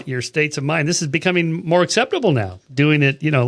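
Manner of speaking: ordinary talk, nothing marked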